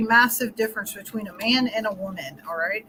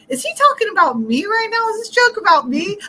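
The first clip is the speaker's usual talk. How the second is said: higher, louder, worried self-questioning; anxious inner voice; 10 semitones higher, 6 dB louder than usual. Retold or imitated. Wife replaying husband's story, anxiously. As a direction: fret that the joke is about you. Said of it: "and you're thinking".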